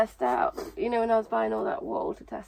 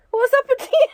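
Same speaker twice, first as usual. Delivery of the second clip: high squealing laugh through the words; laughing shriek; 12 dB louder than usual. Real, not acted. Sees partner in wig.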